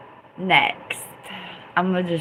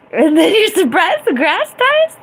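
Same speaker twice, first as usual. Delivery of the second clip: high, loud baffled question; can't believe the grass dies; real bewildered squeal; incredulous; 11 semitones higher, 6 dB louder than usual. Real, not acted.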